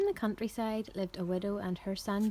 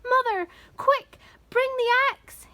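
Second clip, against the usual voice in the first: high, loud call for help; urgent shout; 7 dB louder than usual. A narrator's reading. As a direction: shout for Mother in a hurry. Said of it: "he shouted".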